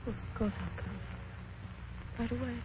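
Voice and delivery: drained into near silence by the news; stunned hush. Acted. Woman on the phone hearing her fiancé leaves, stunned and soft.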